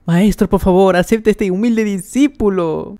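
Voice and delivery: súplica asombrada, aguda y exclamada; muy sorprendido. A narrator's reading. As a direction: arranca fuerte y agudo, como rogándole al maestro. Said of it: "El doctor muy sorprendido le dice". (ruego exaltado de discípulo asombrado)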